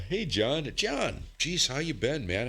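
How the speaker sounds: high surprised hello; excited greeting. Real, not acted. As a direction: greet him like a long-lost friend. Greeting an old friend.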